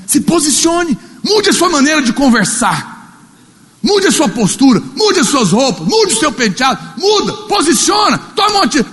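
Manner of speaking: high, loud exhortation building to a shout; rally them to change, rising to a cry; preaching at full force. Acted. (pregando com força, subindo até gritar)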